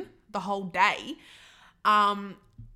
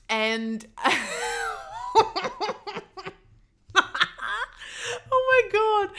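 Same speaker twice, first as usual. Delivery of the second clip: laughing; 11 semitones higher, much slower than usual. Real, not acted. Laughing as she recalls her first date after her ex. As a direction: burst of laughter at the memory.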